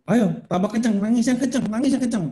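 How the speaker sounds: high, insistent acted urging; mock coach cheering on crying. Demonstrating urging child to cry harder.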